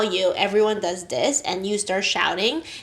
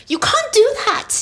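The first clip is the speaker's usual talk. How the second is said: put-on high, slow British-accented protest; attempted British accent; 14 semitones higher, 3 dB louder, slower than usual. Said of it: "Sorry for my poor accent".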